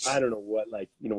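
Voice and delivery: ordinary talk, nothing marked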